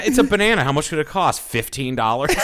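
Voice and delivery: high, loud clueless rich voice; out-of-touch rich guy. Retold or imitated. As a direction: play it clueless about prices, high. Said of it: "She was like".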